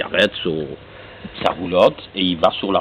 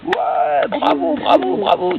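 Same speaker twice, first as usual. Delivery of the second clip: high, excited bravos; cheering crowd; 4 dB louder, much slower than usual. Acted. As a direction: cheer like the whole audience.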